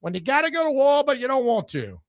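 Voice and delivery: sing-song the war bond pitch; old-time commercial. Pretend. Mocking a 1938 radio commercial, high mock ad voice. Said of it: "some commercial, like, nineteen thirty-eight".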